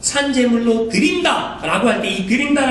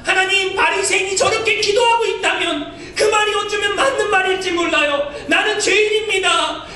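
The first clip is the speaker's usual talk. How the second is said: much higher, earnest cry of a sinner; repentant pleading prayer; 9 semitones higher than usual. Retold or imitated. 세리가 자신을 죄인이라 고백하며 울부짖듯 간구하는 목소리. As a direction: plead for mercy as the lowest of sinners.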